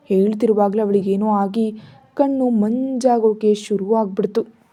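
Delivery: ordinary delivery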